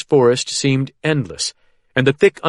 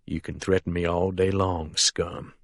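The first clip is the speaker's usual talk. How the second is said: much softer, lower and cold; soft menace; 5 semitones lower, 9 dB quieter than usual. A narrator's reading. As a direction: keep it quiet and dangerous. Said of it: "Dennis says softly".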